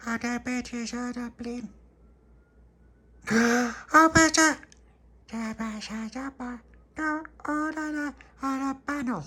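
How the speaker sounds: put-on very high, slow babbling toddler; baby babble. Baby Lucia babbling an idea, very high.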